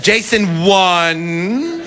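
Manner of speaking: slow, warning count like a referee; counting mother. Retold or imitated. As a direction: stretch the count out like a mom. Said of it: "she counted at him".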